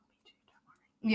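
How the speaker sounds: unvoiced whisper; a soft whispered don't worry; whispered reassurance. Real, not acted. Reassuring a friend.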